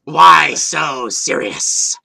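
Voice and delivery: slow, loud Joker-style delivery; hammed-up movie villain quote; Joker line. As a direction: drag out the famous villain line. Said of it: "Joker line quoted".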